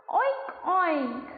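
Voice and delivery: oink like a piglet. Shown as a demonstration. Teacher invites kids to oink along. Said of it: "Can you make a sound with me?".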